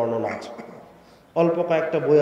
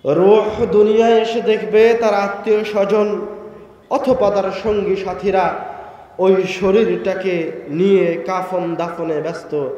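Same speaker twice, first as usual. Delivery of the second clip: loud fervent sermon voice, higher; preaching at full force; 6 semitones higher, 6 dB louder than usual. Acted. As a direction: preach it with rising passion.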